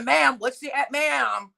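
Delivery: ordinary talk, nothing marked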